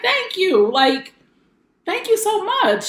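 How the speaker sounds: high, moved little-sister thanks; a touch of tears in a grateful thank you; teary thank you. Retold or imitated. Little sister thanking her big sister, mildly tearful and high. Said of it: "I was like".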